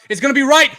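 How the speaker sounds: yelling at the kick; loud excited yell; shouting. Real, not acted. Field goal attempt, yelling.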